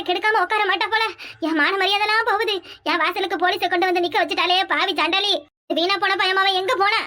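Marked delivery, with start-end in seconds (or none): none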